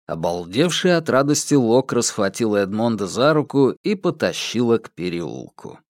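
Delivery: plain reading voice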